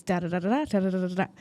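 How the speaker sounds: quick, loud run of da-da-da; imitated comic jingle. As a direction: rattle off a punchy musical sting. Imitates comic sting in skit idea.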